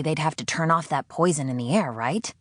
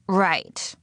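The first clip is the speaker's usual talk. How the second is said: higher, dry falling Right; sarcastic; 5 semitones higher than usual. Acted. Sydney mocking her captor's claim, dry sarcasm. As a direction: drip with sarcasm.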